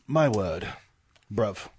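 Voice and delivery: low, understated British dismay; mock British accent. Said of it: "the British are like".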